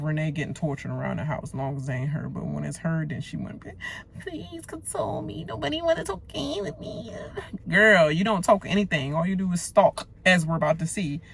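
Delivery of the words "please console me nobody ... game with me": high, whispered mock whine; high breathy mockery of her neediness; mock whiny plea. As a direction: mimic a self-pitying whimper. Mocking houseguest's whine.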